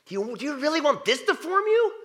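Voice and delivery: high, loud challenging question; animated. Real, not acted. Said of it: "when I get all animated".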